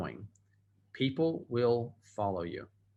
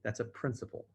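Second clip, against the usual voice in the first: ordinary delivery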